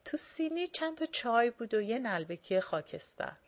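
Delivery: plain reading voice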